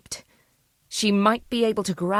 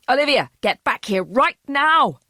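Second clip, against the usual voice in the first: higher, louder furious scream; 7 semitones higher, 6 dB louder than usual. A narrator's reading. Father screams at fleeing daughter from the back door. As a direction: scream after her in rage. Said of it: "he screamed".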